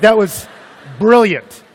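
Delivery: high, exclaimed mock praise; loud sarcasm. Pastor mocking his own job decision, sarcastic shout. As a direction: shout the praise with heavy irony.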